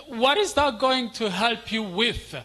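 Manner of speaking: ordinary delivery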